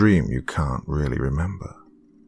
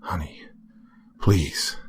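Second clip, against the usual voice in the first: soft plea; 5 dB quieter, slower than usual. Acted. Husband calming wife.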